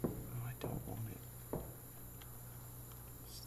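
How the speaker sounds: fast scared whisper. Real, not acted. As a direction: rush it out in fear.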